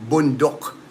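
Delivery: bundok said the Tagalog way; short u, rounded o in bundok; Philippine pronunciation. Shown as a demonstration. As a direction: demonstrate bundok as Filipinos say it. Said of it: "That's how it's pronounced here".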